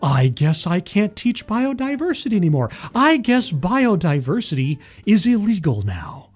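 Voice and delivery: loud, theatrical outraged protest; mock indignation. Host playing a science teacher resigning in protest, mock-outraged. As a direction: grandstand like a teacher quitting on principle.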